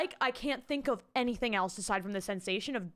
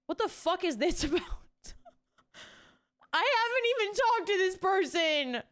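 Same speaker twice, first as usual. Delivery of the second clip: incredulous; 7 semitones higher than usual. Real, not acted. Surprised at the question.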